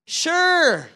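high, loud sure; called answer. Retold or imitated. Husband answering wife upstairs, loud cheerful call. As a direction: call back up the stairs.

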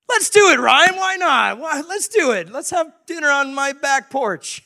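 high, loud, gung-ho outburst; eager agreement. Retold or imitated. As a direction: jump in with over-the-top enthusiasm. Neighbor agrees eagerly to dinner.

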